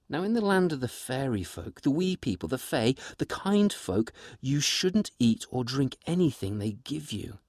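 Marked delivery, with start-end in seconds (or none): none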